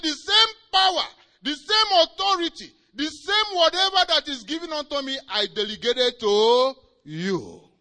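high, loud proclamation, slowing at the end; Jesus proclaiming. Retold or imitated. Jesus telling Hagin about his power, proclaiming. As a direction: declare the authority handed over. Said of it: "And he said".

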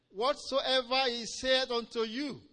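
loud, high, slow proclamation; proclaimed instruction. Retold or imitated. Preacher repeating the instruction to believers, slow proclamation. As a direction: stretch it out with full emphasis.

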